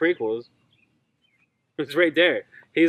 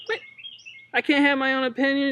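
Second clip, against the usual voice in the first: high, fast indignant protest; mock indignant; 11 semitones higher, faster than usual. Defending his Jango opinion, high and indignant. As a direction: squeak it out, offended. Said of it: "And I'm like".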